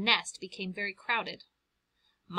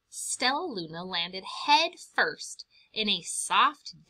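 plain reading voice

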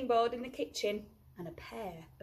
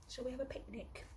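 quick, much softer whisper; 3 semitones higher, 13 dB quieter, faster than usual. Acted. Baby Bear whispering a picnic idea to the owl.

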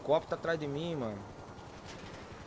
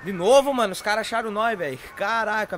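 exasperated shout; 3 semitones higher, 7 dB louder than usual. Real, not acted. Police found him again.

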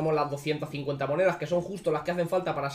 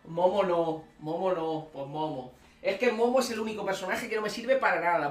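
usual speaking voice

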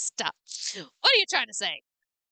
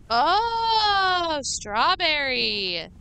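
high, loud, drawn-out delighted cry; excited outburst; 5 semitones higher, 6 dB louder, much slower than usual. Real, not acted. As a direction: stretch it out like a thrilled discovery. Delighted find in the game.

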